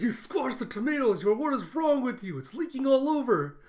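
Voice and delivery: high, loud mock outrage; exasperated scolding. Camilla to Yor about squashed tomatoes, loudly exasperated. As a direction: imitate someone scolding at the top of the voice.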